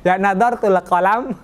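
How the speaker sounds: usual speaking voice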